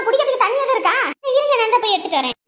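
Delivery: ordinary delivery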